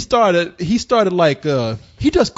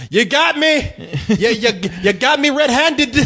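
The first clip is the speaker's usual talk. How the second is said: mock preacher; 6 semitones higher, slower than usual. Preacher mocking the devil accusation.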